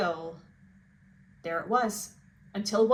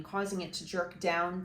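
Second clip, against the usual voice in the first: usual speaking voice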